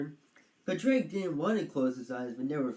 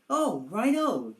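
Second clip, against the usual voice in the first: higher, slow and drawn out; lightly played relieved dragon; 7 semitones higher, 5 dB louder, slower than usual. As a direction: let the worry go.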